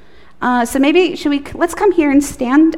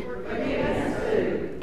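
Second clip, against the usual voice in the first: whispered echo; 13 dB quieter than usual. Real, not acted. Children echo the prayer softly.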